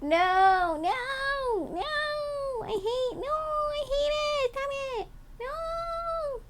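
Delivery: very high, soft pretend wail; squealing sprayed cat. Cat begging not to be sprayed, mock squealing. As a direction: whine in a tiny, high creature voice, stretching the no's.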